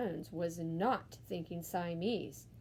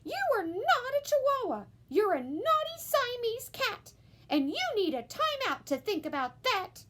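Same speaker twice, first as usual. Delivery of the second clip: scolding mama; 5 dB louder than usual. A narrator's reading. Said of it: "she scolded".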